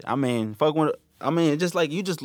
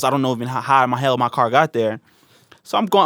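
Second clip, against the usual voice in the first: ordinary talk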